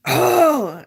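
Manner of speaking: high, loud drawn-out "oh"; retold holler. Soldier crying out at leg pain, hollering. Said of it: "and I'm hollering".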